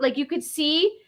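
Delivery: ordinary talk, nothing marked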